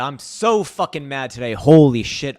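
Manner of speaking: loud, furious venting to the camera; real anger at the start of the stream; angry rant. Real, not acted. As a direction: let the fury spill out, loud. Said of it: "I'm mad".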